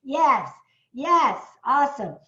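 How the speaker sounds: loud, high hype shout; fired up. Real, not acted. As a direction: pump up the crowd. Hyping up webinar audience.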